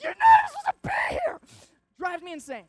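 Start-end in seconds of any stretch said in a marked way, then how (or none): 0.0-1.4 much higher and louder, a full yell; outraged yell. Retold or imitated. Comedian yelling at a girl in the mosh pit, outraged yell. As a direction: yell it over the crowd in disbelief.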